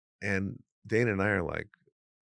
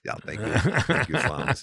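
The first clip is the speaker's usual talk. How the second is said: Hans thanking Franz; 6 semitones higher, 5 dB louder than usual. Retold or imitated. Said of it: "we're talking like Arnold Schwarzenegger".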